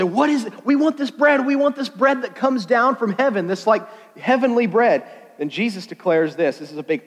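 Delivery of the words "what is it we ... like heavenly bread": higher, insistent pleading; lightly acted excited demand; eager crowd.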